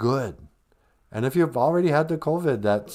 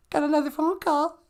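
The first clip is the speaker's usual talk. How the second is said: partner voice; 13 semitones higher than usual. Pretend. Host playing his co-host calling him, high and sweet.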